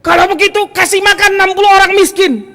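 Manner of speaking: very high, loud command; acted exasperated ruling. Prophet orders companion to feed poor, loud exasperation.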